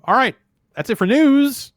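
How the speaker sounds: high, loud show-host sign-off; closing the news like a radio host; upbeat sign-off. Real, not acted. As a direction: wrap the segment with a bright lift. Wrapping up the news segment.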